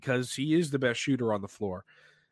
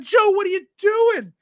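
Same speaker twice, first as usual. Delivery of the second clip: very high, loud fan shriek; re-enacted outburst from a watching fan; screaming; 7 dB louder than usual. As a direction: shriek in disbelief at the player. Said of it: "one of the ... that was screaming".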